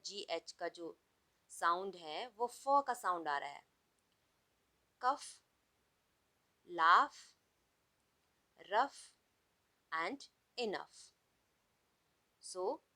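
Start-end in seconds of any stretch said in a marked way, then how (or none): 5.0-11.0 कफ, laugh, rough, enough with the gh said as f, laugh loud; teacher repeating the words; gh read as f. Shown as a demonstration. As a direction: read them out with a clear f ending. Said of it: "read these words".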